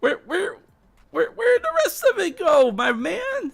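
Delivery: high, squeaky mock indignation; mock outrage. Mock demands where syllables went.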